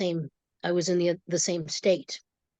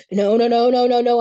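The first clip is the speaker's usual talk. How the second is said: loud, higher retold protest in a rapid string; frantic screaming refusal; 4 semitones higher, 9 dB louder than usual. Teller relives being pulled away, loud frantic protest. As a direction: reenact a loud, kicking refusal. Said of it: "I'm kicking and screaming".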